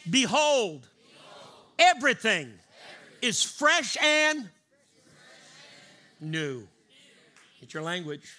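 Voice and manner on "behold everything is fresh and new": building proclamation. Shown as a demonstration. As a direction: build it up and shout behold.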